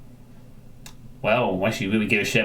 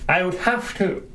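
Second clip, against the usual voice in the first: words stretched through a yawn; 8 semitones higher than usual. Real, not acted. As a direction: say it mid-yawn, mouth wide. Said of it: "yawning now".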